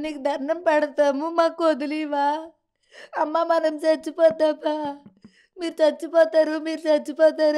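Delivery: choked pleading that breaks into tears; mother recalling the attacker's threat, tearfully. Real, not acted. Retelling pleading for their lives.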